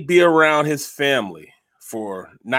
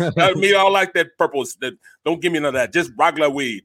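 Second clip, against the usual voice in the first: animated mock Jamaican patois; Jamaican accent; 4 semitones higher than usual. Friend retells brother refusing the purple, imitated Jamaican accent. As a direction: imitate a Jamaican son talking to his pops. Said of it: "with the accent".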